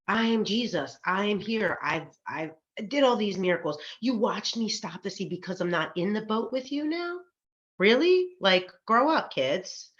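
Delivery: imitated exasperation, peaking on a high "Really?"; exasperated scolding. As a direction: act out a fed-up parent telling off the kids. Jesus scolding panicking disciples, acted out.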